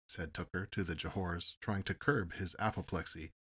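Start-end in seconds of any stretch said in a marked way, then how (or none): none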